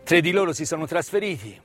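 ordinary talk, nothing marked

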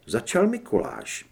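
ordinary delivery

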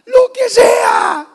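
very high, loud, slow cry; Mary's desperate shout. Retold or imitated. Mary in labour answering José, high crying shout. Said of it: "¿Y qué cree que decía María?".

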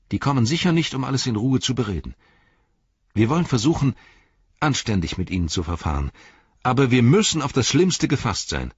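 Mary, eager and fired up; excited Mary. A narrator's reading. Mary voiced by reader. (aufgeregter Mary)

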